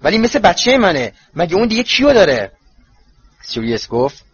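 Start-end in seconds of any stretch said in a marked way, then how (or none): 0.0-2.5 high, loud, vexed retort; exasperated. A narrator's reading. Protective mother argues over a boy like her own, exasperated. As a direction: fire back with heated frustration. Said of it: "خانم ویزلی با حرص گفت".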